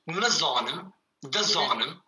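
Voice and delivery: nezanem, dezanem with a wide open A; don't swallow the A in dezanem. Shown as a demonstration. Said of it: "c'est vraiment un A bien ouvert". (le A de dezanem bien ouvert)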